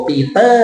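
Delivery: Peter as pee-TUH, long lifted last syllable; Peter, back-weighted, Thai-style; wrong Thai-style Peter. Shown as a demonstration. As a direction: lean on -ter in Peter with an unaspirated t. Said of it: "คนไทยจะบอกว่า".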